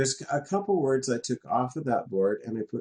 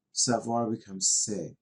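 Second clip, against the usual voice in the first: ordinary talk, nothing marked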